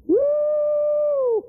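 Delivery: high gleeful woo; laughing whoop. Real, not acted. Preacher whooping at his own story.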